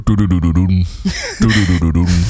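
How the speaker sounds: low, descending game doo-doos; imitated pipe sound. Going down the pipe in Mario, low sinking doo-doos. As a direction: sink down in repeated low notes. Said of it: "dan ging je een pijp in".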